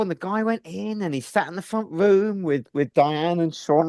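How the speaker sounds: ordinary talk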